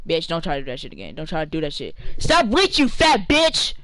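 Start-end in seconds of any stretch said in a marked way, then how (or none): 2.2-3.7 high, loud screaming insult; furious shout. Real, not acted. Gamer screaming at an opponent who keeps reaching, furious.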